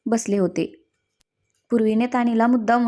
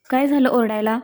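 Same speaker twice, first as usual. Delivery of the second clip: ordinary delivery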